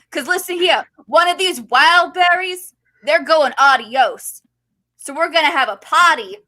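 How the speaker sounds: rally the crowd like a brash New Yorker; swaggering, loud New York accent, picking a fight. Acted. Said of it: "use your best New York accent".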